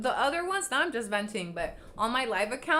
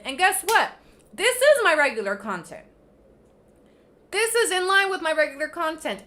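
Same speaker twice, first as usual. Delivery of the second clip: loud, higher, heated yelling; furious shouting; 5 semitones higher, 9 dB louder than usual. Real, not acted. Vlogger yelling at viewers who want old content, furious. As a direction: shout them down, refusing to back off.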